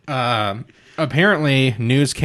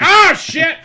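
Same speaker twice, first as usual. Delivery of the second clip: high, loud panicked yell; alarmed referee; 6 dB louder than usual. Retold or imitated. Referee stopping Tyson hitting a downed man, alarmed yell. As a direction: yell it like a ref jumping in to stop things. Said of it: "the ref would be like".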